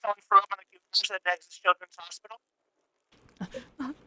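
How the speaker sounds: police radio voice; like a dispatcher on a radio; over a radio. Pretend. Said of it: "the radio voice working".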